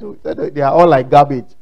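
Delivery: ordinary talk, nothing marked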